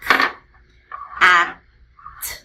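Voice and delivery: "k", "a", "t" as three isolated sounds with long pauses; crisp bare "k", short loud "a", bare "t"; segmented phonemes. Shown as a demonstration. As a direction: sound out "k", "a", "t" one phoneme at a time. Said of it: "how we break up the sounds".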